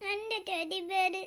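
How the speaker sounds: put-on very soft, slow, half-asleep child; sleepy little boy murmuring about his teddy; drowsy murmur. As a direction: mumble എന്റെ teddy bear as if barely awake. Child half asleep, then delighted.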